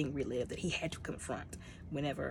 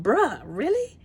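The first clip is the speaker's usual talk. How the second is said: high, loud disbelief; the teller amazed at Quill's risk, loud and high; incredulous outcry; 7 semitones higher, 10 dB louder, slower than usual. Real, not acted. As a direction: cry it out in disbelief.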